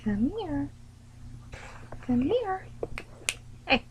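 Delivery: high, sing-song pet call; calling a dog. Real, not acted. Owner summoning her dog, high coaxing call. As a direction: coax the dog over in a high voice.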